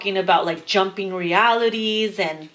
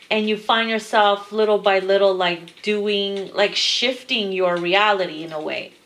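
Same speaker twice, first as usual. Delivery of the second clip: ordinary talk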